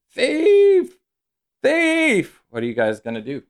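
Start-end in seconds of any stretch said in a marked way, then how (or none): 0.1-2.4 high, loud cry of alarm; shouting after someone. Acted. Man shouting after a fleeing thief, high shout. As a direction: yell it out long and high. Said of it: "this guy is shouting after you".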